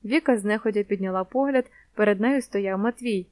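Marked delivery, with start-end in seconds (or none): none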